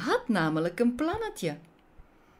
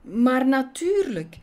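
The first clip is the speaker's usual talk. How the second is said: higher, louder ready assent; obliging, emphatic consent; agreeing queen; 7 semitones higher, 5 dB louder than usual. Acted. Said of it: "knikte de koningin instemmend".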